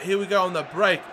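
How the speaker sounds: ordinary talk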